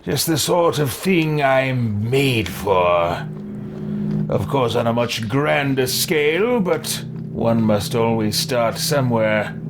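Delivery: lightly voiced gloating villain, a bit slow; villain character voice. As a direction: savour the evil plan calmly. Player voices his villain character.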